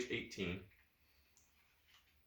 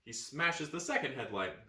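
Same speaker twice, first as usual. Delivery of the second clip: ordinary delivery, nothing marked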